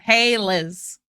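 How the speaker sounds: usual speaking voice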